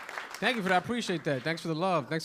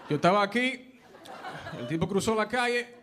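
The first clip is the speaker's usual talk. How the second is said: higher-pitched Spanish-speaking dad impression; dad's Spanish accent; 5 semitones higher than usual. Dad giving cops a statement, Spanish accent. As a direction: rattle off Spanish, then drop one accented English word. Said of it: "My father's like".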